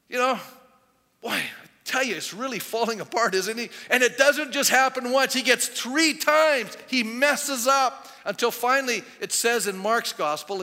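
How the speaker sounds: loud, high-pitched sermon delivery; full-force preaching. Acted. Preacher telling how Peter falls apart, full-force preaching. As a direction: hammer out each failing.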